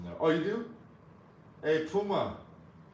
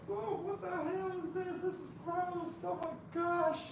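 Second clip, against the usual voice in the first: high, slow mock-disgusted voice; slower than usual. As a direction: squeal it out, grossed out and slow. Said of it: "don't ask me why I do that voice".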